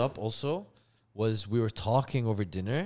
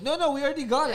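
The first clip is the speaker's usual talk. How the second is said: flustered mom; 12 semitones higher, faster than usual. Retold or imitated. Mom refusing second cake.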